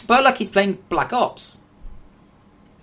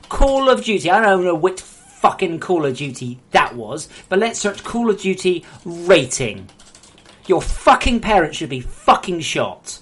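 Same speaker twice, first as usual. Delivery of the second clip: loud angry rant, louder; pissed off; 6 dB louder than usual. Real, not acted. Streamer raging at a kid's parents, loud and angry. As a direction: fume at the parents. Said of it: "I'm pissed off".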